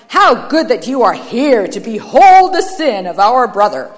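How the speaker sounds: loud, theatrical fault-finding; declamatory, self-righteous accuser; shouted denunciation. A narrator's reading. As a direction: proclaim another's wrongs with relish. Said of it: "he shouted".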